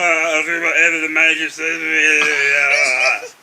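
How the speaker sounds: slow, drawn-out monotone footballer interview voice; mocking a dull striker by talking at half speed; Harry Kane impression. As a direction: drone it out slowly like a bored player at a press conference. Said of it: "He talks like this".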